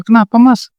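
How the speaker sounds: lightly acted worried wife, pitched high; concerned wife. Istri mendesak suami yang menangis. (istri mendesak cemas, suara meninggi)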